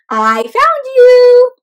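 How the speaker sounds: high, loud, slow gotcha; triumphant find. Acted. Shell finds the pineapple egg, high, loud and slow. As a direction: crow it out, you win.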